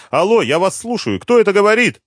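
high, loud frightened shout; mother yelling anxiously on the phone to her son. Acted. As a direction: shout down the line, scared of bad news. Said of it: "Она никогда раньше не кричала".

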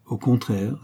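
ordinary delivery, nothing marked

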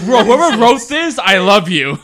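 loud, high, cracking up; laughing outburst. Real, not acted. Friend retelling reaction to the joke, loud laughing shout. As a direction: shout it through helpless laughter.

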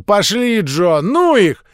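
put-on a kid sneering at little ones' games; loud contempt. A narrator's reading. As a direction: snort it dismissively. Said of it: "громко презрительно фыркнул".

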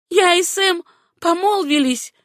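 high, ringing declaration; proud announcement. A narrator's reading. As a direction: announce it with defiant pride. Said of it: "гордо заявила Сюзанна".

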